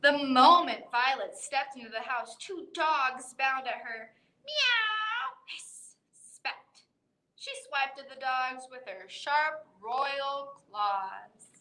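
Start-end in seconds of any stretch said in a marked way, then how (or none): 4.4-6.8 high meow then a breathy hiss; cat meow and hiss. Acted. Reader voicing the cat hissing at the dogs, high meow then hiss. Cat sounds in picture book.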